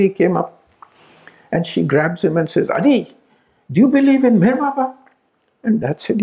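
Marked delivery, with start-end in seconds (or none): 2.7-5.0 high, fast, pressing question; urgent demand. Retold or imitated. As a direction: grab him and demand an answer. Grabs him, demands answer.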